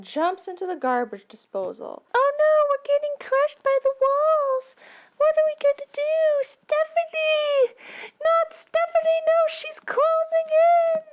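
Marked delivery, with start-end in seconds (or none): 2.1-11.0 high, loud squealing panic; shrill mock panic. Heroes squealing as compactor walls close in, high panic. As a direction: shriek like the walls are crushing you.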